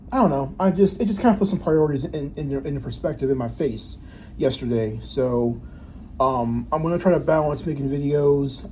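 usual speaking voice

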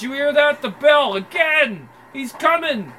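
loud, high panicked warning; panicked alarm. Acted. Thug warning his gang, loud and panicked. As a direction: shout the alarm as the threat closes in.

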